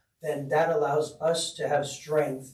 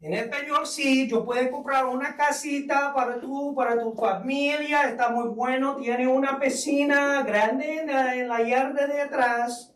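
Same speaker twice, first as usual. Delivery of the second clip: much higher, hammed-up Spanish sales pitch; 9 semitones higher than usual. Shown as a demonstration. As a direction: realtor selling a home in playful Spanish. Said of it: "What about in Espanol?".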